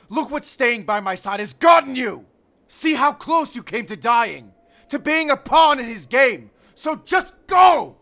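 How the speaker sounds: desperate shout. Acted. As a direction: shout it at him, begging him to go. Urging companion to flee.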